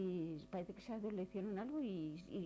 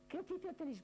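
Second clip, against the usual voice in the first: a soldier's challenge, pitched much higher; interrogating soldier; 10 semitones higher, faster than usual. Retold or imitated. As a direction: bark the question at the tinkers like an officer. Soldiers interrogate the travelling family. (soldado que interroga, con voz mucho más aguda)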